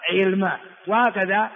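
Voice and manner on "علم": علم with kasra on 'ayn, sukun on lam; moved vowel. Shown as a demonstration. Teacher drilling three forms, last "'ilma". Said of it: "علم في ثلاث لغات".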